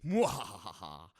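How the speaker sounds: slow spooky villain cackle; evil laugh. Acted. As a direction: drag out a sinister mwahaha. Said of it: "wasn't gonna do a spooky voice".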